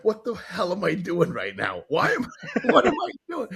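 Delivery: breaking into laughter mid-question; laughing disbelief. Retold or imitated. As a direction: voice climbs high into a laugh. Panicked self-quote while grabbing guy.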